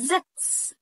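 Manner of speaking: زكس said high and slowly with a buzzing ز; showing the ز sound in زكس; demo pronunciation. Shown as a demonstration. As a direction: lift زكس up high and slow it down. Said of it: "مش زكس".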